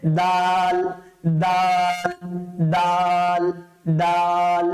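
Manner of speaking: দাল said slow and clear; name the letter দাল carefully; letter name demo. Shown as a demonstration. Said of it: "এটা easy উচ্চারণ".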